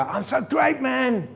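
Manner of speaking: high, loud mirror pep talk; ridiculous over-the-top self-praise; corny self-hype. Shown as a demonstration. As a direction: pump yourself up, hammed-up. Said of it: "Look at yourself in the mirror and go".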